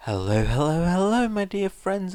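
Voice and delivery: bright, loud, rising hello; excited greeting. Real, not acted. Reader greeting her viewers after the story, excited and loud. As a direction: greet the viewers with a burst of energy.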